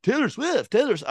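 high, breathless fan squeal; gushing fan voice. Retold or imitated. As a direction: gush like an obsessed fan. Mocking people who rave.

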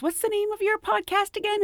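high, light coworker voice; chatty coworker. Retold or imitated. Flight attendant asking Betty about her podcast, high and light. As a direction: ask it brightly. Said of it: "one of them goes".

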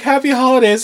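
cheery clerk. Retold or imitated. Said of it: "you're like".

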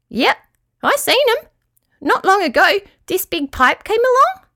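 very high squeaky little creature; Petruchio. A narrator's reading. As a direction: tell it as a squeaky small yabby. Yabby tells what it saw.

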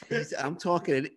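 talking through real laughter; laughing. Real, not acted. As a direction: laugh while protesting mildly. Laughing at yawning co-host.

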